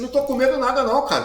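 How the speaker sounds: high, loud, fast mock retort; retruco indignado. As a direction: fire back defensively at a dare. Acted heated retort to a dare. (retrucando rápido, alto e agudo)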